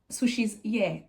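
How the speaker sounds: ordinary talk, nothing marked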